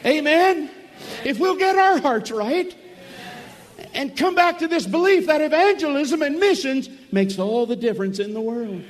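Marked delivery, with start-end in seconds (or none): 0.0-6.2 loud, high revival preaching; preaching at full force. Acted. Preacher rousing the camp meeting crowd, loud and high. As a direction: drive it home to the congregation.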